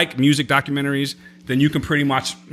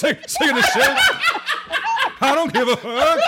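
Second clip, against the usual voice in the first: Bernie Mac impression; 12 semitones higher than usual. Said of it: "sound like Bernie Mac".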